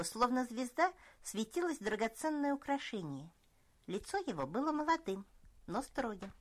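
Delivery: ordinary delivery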